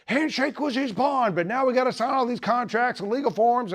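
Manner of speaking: a hint of an old-timer reminiscing; mock eager banker recalling the old days; excited banker. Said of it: "He's like".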